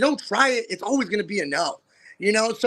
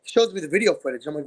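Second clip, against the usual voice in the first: ordinary delivery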